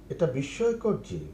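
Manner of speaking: ordinary talk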